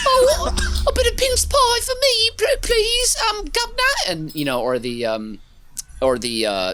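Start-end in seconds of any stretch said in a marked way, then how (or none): 0.1-3.9 very high, loud, laughing mock British accent. Hamming a British accent for friends, squeaky and laughing. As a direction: squeak it out like a cartoon Brit. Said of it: "doing all the best British accents".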